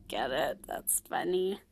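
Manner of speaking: very soft, amused murmur; hushed chuckling aside. Real, not acted. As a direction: let it out under your breath, tickled by your own joke. Hushed aside after her joke.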